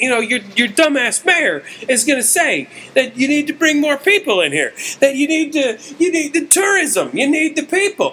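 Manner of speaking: high-pitched ranting comic impression; Bill Burr. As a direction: rant like an exasperated stand-up comic. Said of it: "That is perfect Bill Burr".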